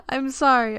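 apologizing through laughter; giggling sorry; laughing. Real, not acted.

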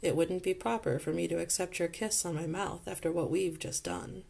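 usual speaking voice

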